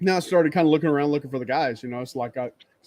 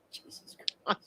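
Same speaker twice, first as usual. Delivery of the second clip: whispered aside; 12 dB quieter than usual. Real, not acted. Reacting to Loki's missing goatee.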